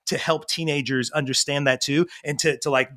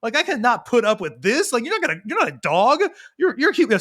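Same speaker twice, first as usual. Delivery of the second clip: high-pitched, retold disbelief; mock exasperation; 8 semitones higher than usual. Dad reacting to his growling son, mock-exasperated and high. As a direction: replay a dad's baffled protest.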